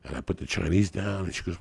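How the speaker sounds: usual speaking voice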